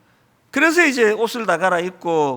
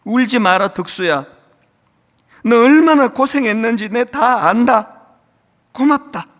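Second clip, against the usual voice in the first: louder, higher Gyeongsang father consoling his son; comforting father; 3 semitones higher, 6 dB louder than usual. Acted. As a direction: hold your grown son and thank him. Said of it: "아들을 안아주면서 이렇게 이야기해요". (아들을 안아주는 아버지처럼 다정하게)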